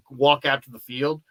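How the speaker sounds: ordinary delivery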